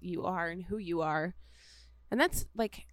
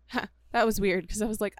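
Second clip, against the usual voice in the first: normal talking voice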